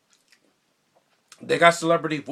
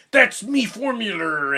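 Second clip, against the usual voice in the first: drawn-out, high and loud pirate impression; Irish pirate Mr. Krabs; 9 semitones higher, 8 dB louder, slower than usual. Fan showing off his Mister Krabs voice, loud Irish pirate. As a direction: belt it out like a cartoon Irish pirate. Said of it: "he's like an Irish pirate".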